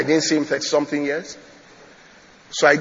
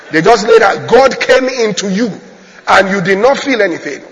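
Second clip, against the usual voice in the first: loud, raised preaching voice; forceful preaching; 4 semitones higher, 8 dB louder than usual. Real, not acted. Preacher tells congregation God came into them, forceful preaching. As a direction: drive it home to the congregation.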